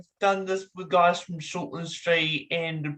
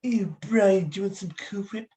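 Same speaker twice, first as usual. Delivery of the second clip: higher cartoon baby voice with a stretched Cool Whip; Stewie Griffin voice; 4 semitones higher than usual. Doing Stewie for the guest to guess. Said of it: "it's Stewie Griffin".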